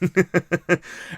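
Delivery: high burst of laughter; laughing. Real, not acted. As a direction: crack up at your own joke. Laughing at own Italian bit.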